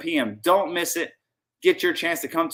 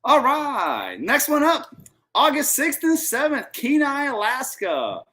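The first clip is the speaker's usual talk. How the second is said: infomercial voice; 7 semitones higher, slower than usual. Real, not acted. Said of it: "You took it too far".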